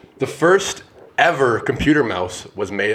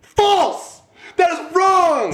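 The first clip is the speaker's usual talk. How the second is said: high-pitched, drawn-out proclamation; triumphant quiz verdict; much slower than usual. Pretend. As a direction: cry out the wrong-answer ruling with relish. Gleefully rejects wrong quiz answer.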